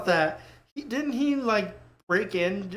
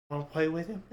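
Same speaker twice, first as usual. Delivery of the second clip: low sly offer; 5 semitones lower, 8 dB quieter, faster than usual. Pretend. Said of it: "and be like".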